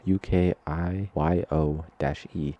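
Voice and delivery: slow, letter by letter; spelled out. Shown as a demonstration. Spelling the art style's name for listeners, slowly. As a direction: spell each letter deliberately. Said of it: "I need to make sure I pronounce this right".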